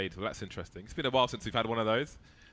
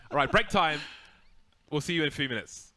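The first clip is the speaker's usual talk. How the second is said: raised, upbeat sign-off; broadcast sign-off; 6 semitones higher, 6 dB louder than usual. Real, not acted. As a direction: announce the break. Caster sends to break.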